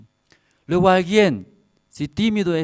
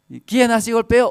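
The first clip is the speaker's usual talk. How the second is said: high, loud indignant demand; angry mother; 9 semitones higher, 8 dB louder than usual. Retold or imitated. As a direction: imitate an outraged mother. Acted mother of Rebeca confronting.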